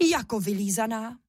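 ordinary delivery

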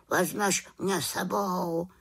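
mildly pleading raven; a touch of begging. Acted. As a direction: ask gently to be taken along. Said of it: "prosil Abraxas".

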